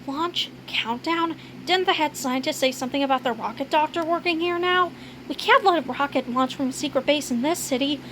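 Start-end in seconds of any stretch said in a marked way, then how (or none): none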